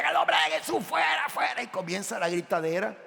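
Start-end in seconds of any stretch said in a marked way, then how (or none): 0.0-1.6 imitating a crowd yelling a high exorcism cry; hammed-up frantic shouting at a demon; mock group shouting. Preacher mimicking a shouting prayer group: high mock yelling. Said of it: "todo el mundo comienza a gritar".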